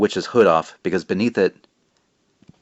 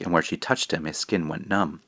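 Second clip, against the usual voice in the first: usual speaking voice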